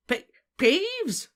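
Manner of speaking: high, bewildered stammer; loud, squeaked stuttering question. A narrator's reading. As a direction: stumble on the name, baffled. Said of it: "stammered Filch".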